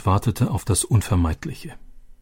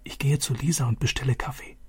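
soft, higher hushed aside; girl whispering to her father; whispered to father; 4 semitones higher, 8 dB quieter than usual. Acted. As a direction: lean in and speak softly to father. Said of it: "flüsterte Vater zu".

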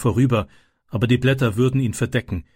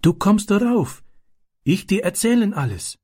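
put-on higher boy's voice in halting German; higher child voice, broken German; Ram's eager voice; 8 semitones higher than usual. A narrator's reading. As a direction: urge him up, friendly and keen. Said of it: "flüsterte Ram".

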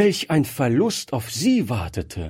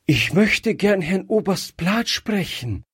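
higher, lightly voiced girl out of breath; slightly breathless; 9 semitones higher than usual. A narrator's reading. Gretchen asks the maid for the colonel, a touch breathless. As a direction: ask urgently, just a touch breathless. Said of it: "sagte Gretchen noch atemlos".

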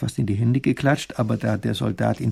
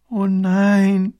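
higher, louder exasperated groan; 8 semitones higher, 6 dB louder than usual. Acted. Princess groans at the soldier's pointless watch. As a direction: groan it out in dismay. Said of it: "stöhnt die Prinzessin".

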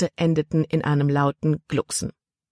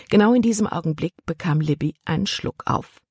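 ordinary delivery, nothing marked

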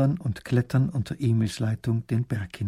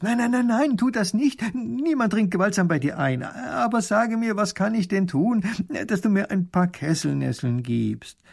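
horrified; 9 semitones higher than usual. Acted. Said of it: "ruft ganz entsetzt".

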